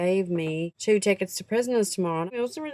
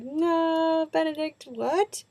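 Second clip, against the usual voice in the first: embarrassed squeal; 6 semitones higher, much slower than usual. Retold or imitated. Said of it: "I was just like".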